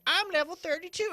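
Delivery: high, sing-song imitation of the wife; lightly voiced perky wife; mock wife voice. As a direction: brag it lightly in a put-on high voice.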